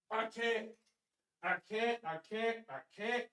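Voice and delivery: fading out, barely voiced; can't go on. Real, not acted. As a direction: spent from laughing, near silent. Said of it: "give me a second".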